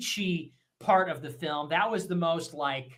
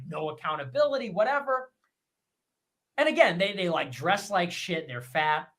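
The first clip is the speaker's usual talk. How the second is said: usual speaking voice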